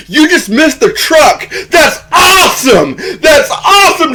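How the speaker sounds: very high, loud, hammed-up yelling; crazy excited. Imitating Dusty reaching Melissa after the truck falls, crazy excited. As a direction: whoop it out in wild mock amazement. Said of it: "I'm getting crazy".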